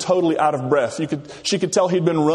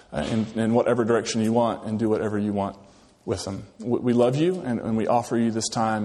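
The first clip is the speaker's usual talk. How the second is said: prayer; 4 semitones lower than usual. Acted. Pastor leads opening prayer.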